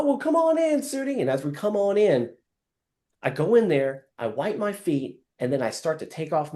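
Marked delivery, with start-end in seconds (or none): 0.0-1.2 high, friendly host voice; homeowner inviting in. Acted. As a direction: play the welcoming homeowner.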